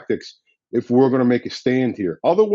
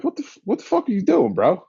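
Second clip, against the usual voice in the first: retold disbelief, voice jumping much higher; incredulous; 9 semitones higher than usual. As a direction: relive the shock of seeing your buddy with a bullhorn. Retold shock at seeing bullhorn.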